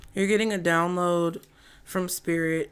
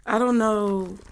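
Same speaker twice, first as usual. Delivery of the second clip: odd delivery; 3 semitones higher, 4 dB louder than usual. Real, not acted. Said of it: "why I said it like that".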